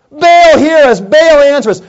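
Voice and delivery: loud, high-pitched cry to Baal; prophets calling out. Acted. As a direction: call out insistently to a god.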